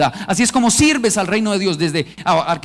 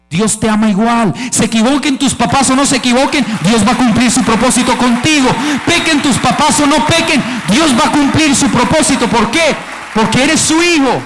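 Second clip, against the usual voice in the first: louder, higher rising sermon; preaching at full force; 5 semitones higher, 5 dB louder than usual. Real, not acted. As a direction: build the crowd up with fervor. Preaching climax. (predicando con fuerza creciente)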